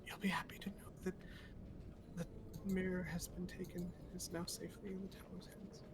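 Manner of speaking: breathy whisper, fast. Acted. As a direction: hush it, as if sharing a secret. Secret news told in hushed voice.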